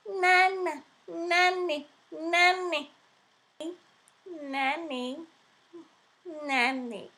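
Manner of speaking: put-on high child's voice, repeating nanny; toddler calling out. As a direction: imitate a little boy babbling.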